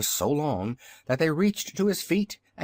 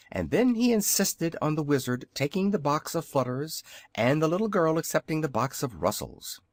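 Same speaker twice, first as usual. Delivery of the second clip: ordinary delivery, nothing marked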